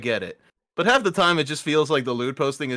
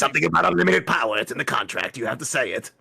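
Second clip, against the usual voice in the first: Palpatine voice; 2 semitones lower than usual. Acted.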